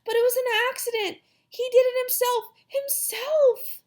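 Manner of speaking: put-on high, insistent little-girl wail. A narrator's reading. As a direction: wail your excuse like an upset girl. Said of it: "Sheila cried louder".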